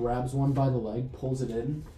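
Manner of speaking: usual speaking voice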